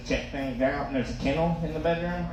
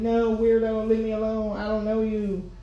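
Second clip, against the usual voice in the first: put-on embarrassed kid; 7 semitones higher than usual. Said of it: "I'd be, like".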